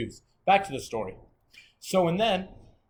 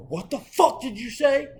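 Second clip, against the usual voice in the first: high, loud, slow indignant shout; outraged challenge; 10 semitones higher, 7 dB louder, slower than usual. Retold or imitated. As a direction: demand it back, incredulous and loud. His outraged reply, acted out.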